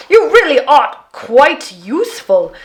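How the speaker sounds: loud, merry Viking shout; cheerful Viking cry. A narrator's reading. Viking cheers the girl while rowing, loud and merry. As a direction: call it out with joy. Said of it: "he cried".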